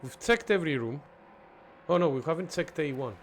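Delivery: ordinary talk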